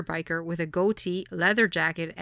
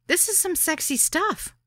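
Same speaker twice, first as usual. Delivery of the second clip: insisting; 11 semitones higher than usual. Retold or imitated. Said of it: "I insisted".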